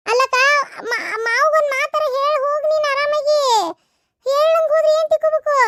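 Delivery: a younger woman coaxing her sister-in-law to stay; high coaxing voice of a young wife. Acted. Second woman voiced in sketch.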